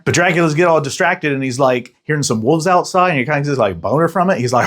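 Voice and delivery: normal talking voice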